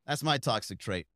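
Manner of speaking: ordinary delivery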